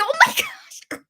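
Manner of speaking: high, laughing oh my gosh; laughing squeal. Real, not acted. Vlogger reacting to mom's dating idea, laughing squeal.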